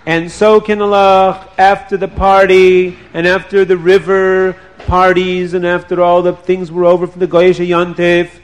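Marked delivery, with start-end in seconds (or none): none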